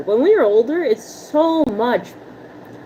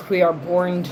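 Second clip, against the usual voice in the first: We are born, in a lower put-on voice; jokey lower voice about Jersey speech; 3 semitones lower than usual. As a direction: drop into a mock dramatic character voice.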